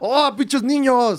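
put-on slow, high, grumbling old man; grumpy old man. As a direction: drag out the gripe like a fed-up old grouch. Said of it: "Los odio a todos".

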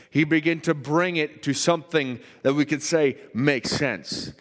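full-force preaching. Acted. Pastor preaching to his church about God's order, impassioned and loud. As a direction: preach it out, driving each phrase with rising fervour.

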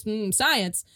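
ordinary talk

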